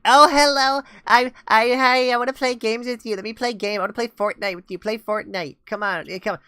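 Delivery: mock a squeaky young kid begging to play; squeaker kid. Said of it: "then they sound like".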